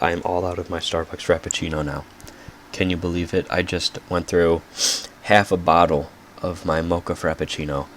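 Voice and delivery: usual speaking voice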